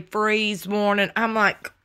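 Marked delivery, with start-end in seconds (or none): none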